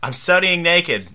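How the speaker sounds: slow, loud, high blurted yell. Acted. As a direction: yell it out without thinking. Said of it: "Ryan yelled back".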